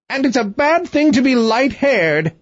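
high-pitched mock scream, no real volume; acted shrieking last word; screamed retort. A narrator's reading. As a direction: screech it like a cross queen. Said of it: "screamed she".

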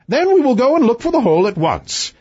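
high, imperious royal voice; haughty king. Acted.

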